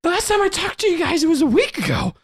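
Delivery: very high, overwrought rambling; Brantley, distraught. Acted. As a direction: pour it out in a squeaky, upset voice. Brantley recounts searching for his friends.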